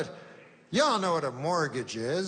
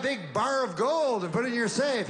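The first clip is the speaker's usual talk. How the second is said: higher, bright sales pitch read; TV ad pitchman; 7 semitones higher than usual. Retold or imitated. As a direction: sell gold like a TV commercial. Mocking a TV gold ad.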